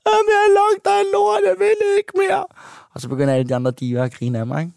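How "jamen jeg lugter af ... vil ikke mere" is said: very high, loud mock wail; hammed-up wailing breakdown. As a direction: cry it out in an exaggerated squeal of despair. Acts out crying breakdown on camera. (overspillet, højt og skingert hyleri)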